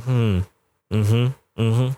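low, imitated hums; pondering doctor. Doctor studying his left side, imitated pondering hums. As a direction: lightly mimic a noncommittal examiner.

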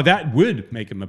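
would punched loud, correcting the other speaker; stress on WOULD. Real, not acted. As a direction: hit the word would hard. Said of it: "That's not a might".

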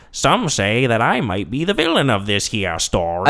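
caricatured put-on accent with swooping pitch; Tom Hanks. Podcaster mocking a film trailer, caricature impression. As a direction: overdo the drawling villain narrator. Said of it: "it's Tom Hanks's voice playing that character".

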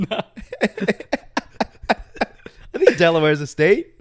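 high, slow words through laughter; real cracking-up delivery; laughing. Real, not acted. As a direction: giggle through an unsure answer. Laughing at own confusion.

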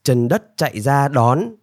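plain reading voice

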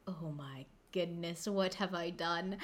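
hushed, slow horrified whisper; breathless mock dread; mock panicked whisper. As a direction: whisper it like a small disaster. Retold panic in a story.

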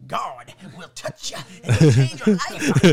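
slow, loud preacher voice with a laugh; mock preacher. Teen mimicking the evangelist, hammed-up and slow. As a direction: boom it out like a revival preacher.

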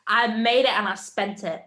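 ordinary talk

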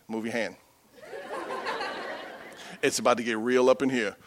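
low, soft muttered warning; hushed mock threat, half laughing; mock warning to wife. Husband warning wife in traffic, low and soft. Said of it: "and I'm like".

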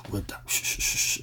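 quick unvoiced shh sounds; soft shushing hiss. Real, not acted. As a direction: hush it under your breath. Shushing while someone is near.

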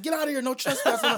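high, loud mock yell through the speaker; yelling over intercom. Jamie Foxx yelling over his intercom, loud and high. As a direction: bark it out like a guard on the intercom. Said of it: "He's like".

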